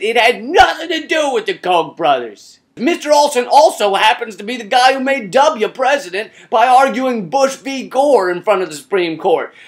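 usual speaking voice

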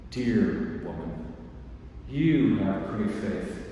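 low, slow, gentle voice; tender. Acted. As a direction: soften and bless her slowly. Jesus tenderly answers the woman.

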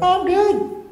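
squeaky high mock of 'I'm good'; mocking the client's complacent answer. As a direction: mimic the smug brush-off, way up high. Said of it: "you're going".

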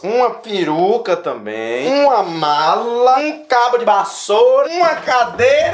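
mounting comic exaggeration; escalating list. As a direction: build slowly to a high pitch. Absurd list escalates to a yell.

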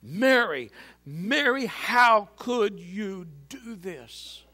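rising, slow, anguished plea; anguished cry. A narrator's reading. Joseph pleading to absent Mary, anguished cry. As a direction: cry out her name in heartbreak. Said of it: "between his dry lips cried".

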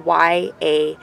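very slow, letter by letter; slow, evenly spaced letters; spelling out. Shown as a demonstration. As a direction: spell it out one letter at a time. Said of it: "it's spelled Yahuwah".

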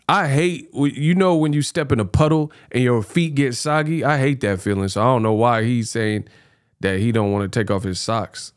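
ordinary talk

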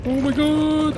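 high, loud panicked cry; frightened scream. Real, not acted. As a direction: shriek as they grab you. Monsters catching him in game.

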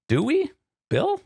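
high-pitched, drawn-out sceptical question; mock doubt. Reviewer questioning the comic about going to church, mock doubt.

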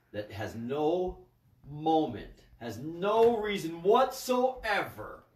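slow, loud, high stretched words; drawn-out scolding. Real, not acted. Scolding the listeners who did not repeat, slow and loud. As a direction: stretch it out to shame them.

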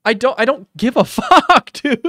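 loud, high, cracking up mid-line; laughing outburst. Real, not acted. Shutting down the banana opinion, laughing outburst. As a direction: burst out and break into laughter.

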